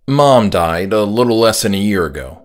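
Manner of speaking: ordinary delivery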